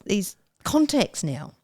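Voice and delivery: ordinary talk, nothing marked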